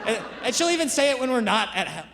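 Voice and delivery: usual speaking voice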